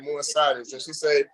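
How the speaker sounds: usual speaking voice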